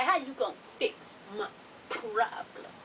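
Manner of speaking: low, hushed imitation of him; creepy man's act. He answers the triage lady, low hushed mimicry. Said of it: "He doing this shit".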